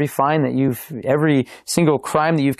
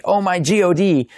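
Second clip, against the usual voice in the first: higher-pitched pretend outburst; mock exclamation; 6 semitones higher than usual. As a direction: cry out as if stubbing a toe, half-hearted. Imitates a stubbed-toe exclamation.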